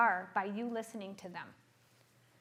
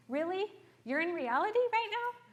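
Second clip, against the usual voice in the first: incredulous challenge; 9 semitones higher than usual. Retold or imitated. Therapist challenging a client.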